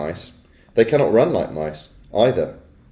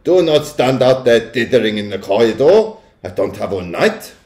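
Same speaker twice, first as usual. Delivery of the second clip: impatient witch with a put-on accent; snapped; 5 dB louder than usual. A narrator's reading. Witch hurrying the witches in from the corridor, snapping. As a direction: scold the ditherers as an imperious witch. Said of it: "snapped the Grand High Witch".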